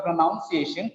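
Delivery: "pronunciation" as pro-noun-ci-ation; wrong pronunciation, "noun". Shown as a demonstration. Teacher rejecting a misreading, "pronunciation" with "noun" inside. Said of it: "It is not pronunciation".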